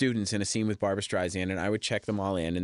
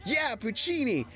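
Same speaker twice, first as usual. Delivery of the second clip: high, slow goofy fanboy cheer; goofy extras; 13 semitones higher, slower than usual. Retold or imitated. Extras gushing for [name], high slow goofy cheer. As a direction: cheer it like a dopey eager extra. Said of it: "We're like".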